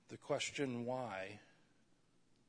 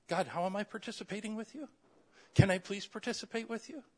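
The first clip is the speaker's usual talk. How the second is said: eager plea; 9 semitones higher, 8 dB louder, faster than usual. Acted. Acting an eager believer praying.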